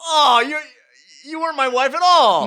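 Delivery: high, loud dismayed outcry; hammed-up shock at betrayal; shocked realization. Husband realizes the deception.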